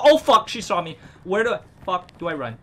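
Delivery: loud, high panic; panicked yelling. Real, not acted. Gamer spotted by the monster, panicked shout. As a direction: shout in real fright.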